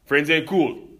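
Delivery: put-on preachy Jamaican-accent rant; imitating the barber's Jamaican rant about friends. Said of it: "his little rant".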